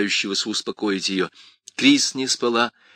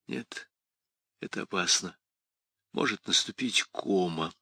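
grim quiet; 7 dB quieter than usual. Acted. Grim reply.

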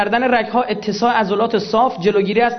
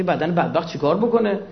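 usual speaking voice

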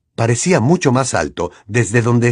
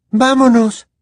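put-on higher girl's voice, urgent; Hermione exclaiming; 13 semitones higher, 4 dB louder, slower than usual. A narrator's reading. Hermione urging Harry to leave, higher and louder. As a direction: call him away, a little louder. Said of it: "exclamó Hermione".

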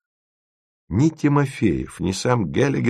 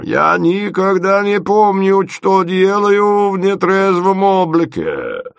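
high, loud, slow complaining voice; whiny hungover inventor; 8 semitones higher, 4 dB louder, slower than usual. Acted. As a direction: wail it high like a wretched drunk.

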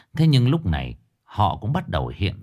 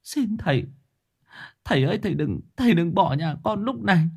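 begging the master, much higher and tearful; desperate pleading; 13 semitones higher than usual. Acted. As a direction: plead on your knees for your son. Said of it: "quỳ sụp xuống mà năn nỉ". (bà Hoài van xin thầy cứu con, giọng cao khẩn khoản)

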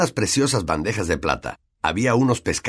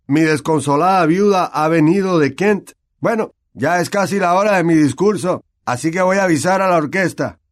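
higher, louder hearty host; genial ghost host; 9 semitones higher, 6 dB louder than usual. Acted. Nick greeting his party guests, hearty. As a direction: welcome the guests.

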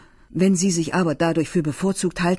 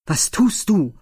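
sharp call; 3 semitones higher, 5 dB louder than usual. Acted. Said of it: "rief er".

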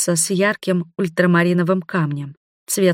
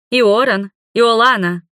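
higher, louder mock-stern call; 4 semitones higher, 4 dB louder, slower than usual. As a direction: call them out, playfully stern. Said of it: "нарочито грозным тоном".

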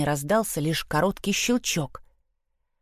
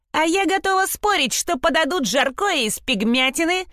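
shouted through the keyhole, much higher; defiant taunt yelled at the boys; shouting; 10 semitones higher than usual. A narrator's reading. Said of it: "крикнула Шпрота в замочную скважину".

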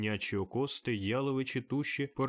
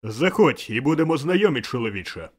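booming call; 3 semitones higher, 12 dB louder than usual. A narrator's reading. The ghost inviting Scrooge to get acquainted, booming call. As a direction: hail him at full volume. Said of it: "гукнув привид до Скруджа".

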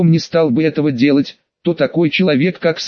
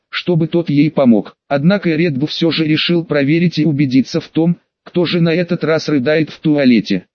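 plain reading voice

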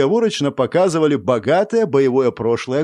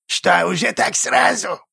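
creaky goblin voice; 6 semitones higher than usual. A narrator's reading. Goblin responds warily.

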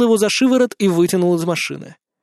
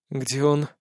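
low, soft, unrecognisable voice; a voice not your own, hushed; strange voice; 3 semitones lower, 5 dB quieter than usual. Acted. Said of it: "сам не узнал собственный голос".

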